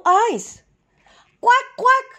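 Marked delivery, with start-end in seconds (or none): 1.4-2.1 much higher, louder duck quacks; calling quacks. A narrator's reading. The stuck duck calls up to the flock, high quacks. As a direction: call out for help like a stranded duck. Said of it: "called the duck".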